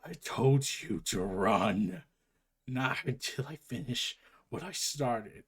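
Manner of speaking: low, slow, wounded Batman growl; groaning Batman. Acted. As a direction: push each word out through the pain. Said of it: "Batman just groans and says".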